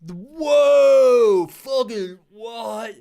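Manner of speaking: hammed-up mind blown; mock astonishment. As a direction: gasp as if stunned, sarcastically. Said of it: "sarcastic mock amazement".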